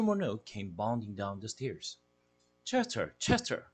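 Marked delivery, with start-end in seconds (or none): 2.6-3.7 high, urgent calling of the name; calling out the cat's name in alarm; high pitched cry for a friend. A narrator's reading. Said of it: "I cried".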